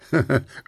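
brief merry chuckle; a quick laugh before the joke about Willi; short laugh. A narrator's reading. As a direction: laugh once lightly. Lachte Puh.

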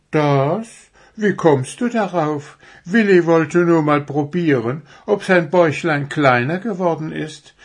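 high, innocent-sounding fib; fibbing. A narrator's reading. Deer covers for the owl to the dwarf, fibbing. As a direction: bluff brightly as a little deer. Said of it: "flunkerte Pünktchen".

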